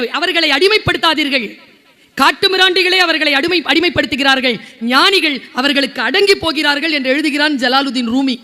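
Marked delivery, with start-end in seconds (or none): none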